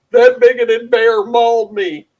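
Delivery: high, drawn-out mock wail; mock screaming. Mimicking a mauled person running around, mock screaming. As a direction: put on a high, slow victim's scream. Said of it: "running around screaming".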